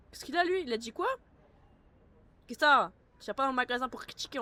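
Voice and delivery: sudden loud, high indignant protest; indignant outburst. Pretend. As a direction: snap back at the rude customer. Reacting angrily to game shopper.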